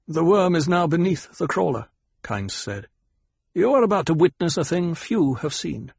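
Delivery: a lightly voiced calm planetologist answering his lord; Kynes, cool desert guide. A narrator's reading.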